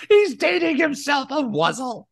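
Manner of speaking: high, laughing through the words. Real, not acted. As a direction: crack up while teasing. Teasing laugh at wuzzle joke.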